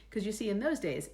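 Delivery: plain reading voice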